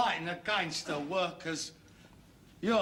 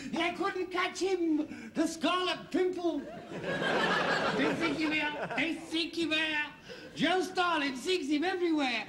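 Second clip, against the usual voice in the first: mock heroic recital; 7 semitones higher than usual. Son mocking father as elusive hero, theatrical high recital. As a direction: recite it like a hammed-up adventure tale.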